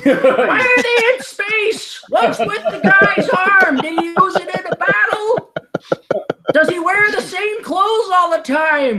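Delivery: confused parent. Retold or imitated. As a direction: ask like a baffled parent.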